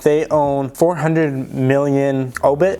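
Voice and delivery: ordinary delivery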